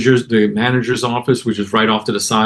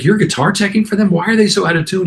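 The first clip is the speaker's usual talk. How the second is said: high-pitched retold disbelief; incredulous questioning; 9 semitones higher than usual. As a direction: act out baffled questions to a friend. Retold baffled questioning of his tech.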